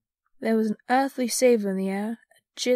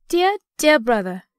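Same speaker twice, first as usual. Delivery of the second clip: put-on loud, fond child's greeting; affectionate little girl; 3 semitones higher, 8 dB louder than usual. A narrator's reading. Girl running to her brother, fondly excited. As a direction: greet a beloved brother eagerly.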